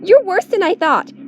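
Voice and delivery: high furious scolding; shrill enraged rebuke; angry. A narrator's reading. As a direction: lash out in disgust. Sif still furious.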